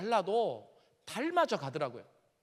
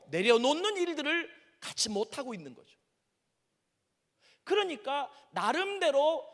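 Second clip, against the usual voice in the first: raised, higher preacher's delivery from the pulpit; preaching at full force; 8 semitones higher, 5 dB louder than usual. Acted. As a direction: press the point home like a preacher. Preaching to the congregation. (설교하듯 힘주어)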